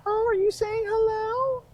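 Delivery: put-on very high sing-song baby talk. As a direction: coo at a toddler in baby talk. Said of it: "she's in her baby voice".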